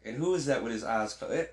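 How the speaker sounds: ordinary talk, nothing marked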